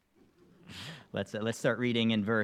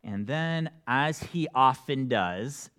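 ordinary talk

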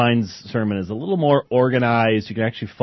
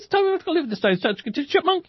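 very high, squeaky sped-up gabble; chipmunk; faster than usual. Acted. Host mimicking squashed sermon audio, chipmunk voice. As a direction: race through it in a tiny chipmunk squeak. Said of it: "so it sounds like you start".

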